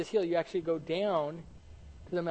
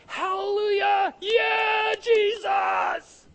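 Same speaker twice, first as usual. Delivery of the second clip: very high, loud cheering; full-voiced acted shout of praise; crowd shouting; 9 dB louder, much slower than usual. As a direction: yell it like a jubilant crowd. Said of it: "Everybody's shouting".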